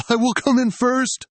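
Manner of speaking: high, loud vow; determined cry. Acted. Runner vowing to win the race, loud. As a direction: shout your resolve to win.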